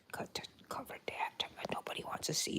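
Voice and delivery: hushed embarrassed whisper. Real, not acted. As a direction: hide it quietly from the viewers. Hiding something from camera.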